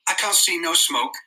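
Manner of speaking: put-on high, doubting boy's voice; incredulous. A narrator's reading. Piggy doubting Ralph's sighting, high and incredulous. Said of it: "said Piggy incredulously".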